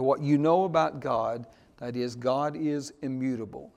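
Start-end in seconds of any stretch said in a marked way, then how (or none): none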